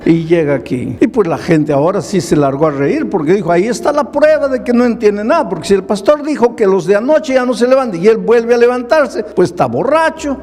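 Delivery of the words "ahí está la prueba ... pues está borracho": mocking crowd voice. Crowd mocks the drunk.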